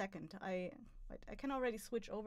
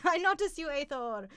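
excited shout; 4 semitones higher, 8 dB louder than usual. Real, not acted. Shouting back to a chatter.

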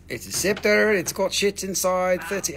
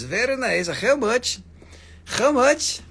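Kazakh-accented Borat impression, higher and louder, rasped h in how; hammed-up Borat accent climbing higher each time; 9 semitones higher, 6 dB louder than usual. Shown as a demonstration. Streamer answers a chat request with a Borat accent. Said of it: "In Borat voice".

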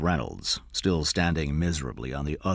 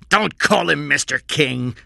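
loud, higher-pitched command; angry shout; 10 semitones higher, 8 dB louder than usual. A narrator's reading. Cy corrects the captive boy, shouting. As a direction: snap it out forcefully. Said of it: "Cy shouted".

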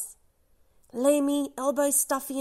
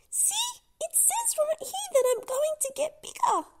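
very high little voice; eager tiny toy; tiny whale voice. Acted. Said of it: "Lula says".

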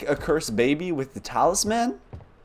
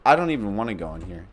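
ordinary talk, nothing marked

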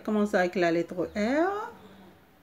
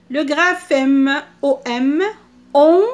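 loud, slow, deliberate teaching voice; emphatic announcement; 2 semitones higher, 9 dB louder than usual. Shown as a demonstration. Teacher presents a new sound to the class, emphatically.

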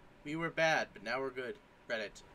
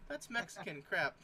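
ordinary delivery

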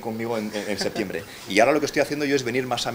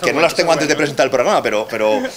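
excited; 4 semitones higher, 7 dB louder than usual. Real, not acted. Excited about giving the speech.

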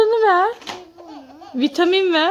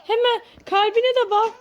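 ordinary delivery

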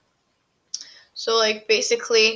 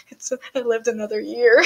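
acting sad. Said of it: "I'm acting all sad".

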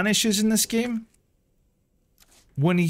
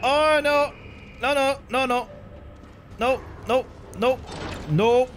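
panicking; 5 semitones higher than usual. Real, not acted. Chased, panicking.

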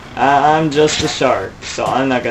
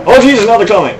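panicked, high and loud; frantic shouting as zombies pour in; terrified horror victim; 4 semitones higher, 7 dB louder, faster than usual. Real, not acted. Zombies rush the open door.

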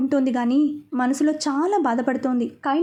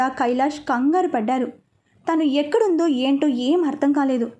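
ordinary delivery

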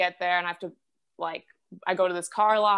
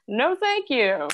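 high, drawn-out refusal; Death declining; 7 semitones higher, 4 dB louder, much slower than usual. Acted. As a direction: turn it down slowly in a high voice. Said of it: "death was like".

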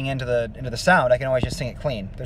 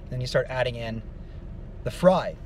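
ordinary delivery

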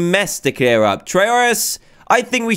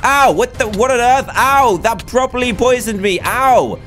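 high-pitched yelps of pain and shock; pained alarm; 6 semitones higher than usual. Real, not acted. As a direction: cry out, startled by a sudden hit. Hit by poison in game.

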